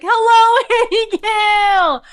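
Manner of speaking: high, slow, drawn-out call; retold yell. Calling to Annie Gail from the top of Nebo, yelling. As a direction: holler it long from the mountaintop. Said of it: "as loud as you can".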